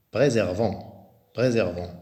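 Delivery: Préservant slow, the s voiced as z: pré-zer-vant. Shown as a demonstration. Teacher modeling the z between vowels for pupils. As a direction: lean on the z in Préservant. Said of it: "Le S se prononce Z".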